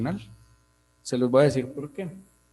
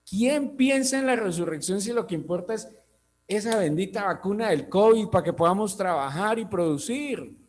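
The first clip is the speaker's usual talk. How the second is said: impassioned preaching; 5 semitones higher than usual. Acted. Preacher's rising rhetorical questions.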